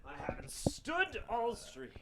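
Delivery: high, very soft mock echo; hushed, high-pitched teasing repeat; soft mimicking echo. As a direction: mimic it back in a tiny high voice. Echoes the other's remark mockingly.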